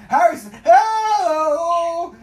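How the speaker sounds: slow, high, loud wailing cry for help; mock crying wail. Begging Harrison for help, drawn-out mock wail. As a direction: stretch it out like a sobbing plea.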